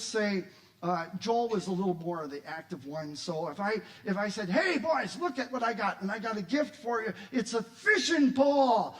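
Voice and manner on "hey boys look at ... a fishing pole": raised, high announcement of a present; excited dad. Acted. Dad surprising his boys with a gift, excited. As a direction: call the boys over and build to a big reveal.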